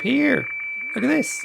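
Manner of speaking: excited. Real, not acted. Excited at fax image appearing.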